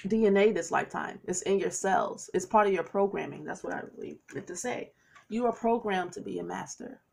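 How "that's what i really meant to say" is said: fast, breathy whispered correction; whispered aside. Real, not acted. Reader correcting herself to viewers in a quick whisper. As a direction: slip it in as a secret aside.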